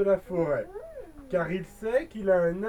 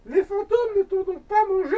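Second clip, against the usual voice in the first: worried mother; 9 semitones higher, 5 dB louder than usual. Acted. Mother voiced in story.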